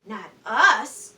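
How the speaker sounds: loud protest; all deny it at once; loud chorus of denial; sailors' cry. Acted. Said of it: "cried the sailors".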